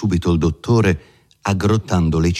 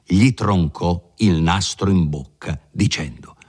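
ordinary delivery

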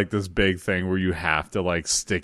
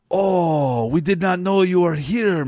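a chef's hammed-up, not-quite French accent, pitched higher; bad French accent; 8 semitones higher than usual. French chef welcoming Hulk Hogan, in a bad French accent. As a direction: fake French chef gushing over a guest. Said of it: "That's not really a French accent".

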